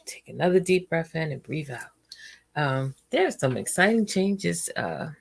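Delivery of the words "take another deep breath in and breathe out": guided breathing. Acted. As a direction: lead a gentle breathing exercise for viewers. Leads a breathing exercise.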